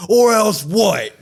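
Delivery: slow, loud, high yelled challenge; shouting at top of lungs. Retold or imitated. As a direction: bellow it out as a furious dare. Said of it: "The top of his lungs".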